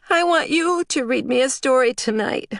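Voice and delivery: put-on high little-girl voice; tiny girl. A narrator's reading. Little Lulu asks her mom for a bedtime story, high tiny voice. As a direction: squeak it like a small child. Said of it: "like a mouse on helium".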